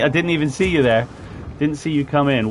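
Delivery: normal talking voice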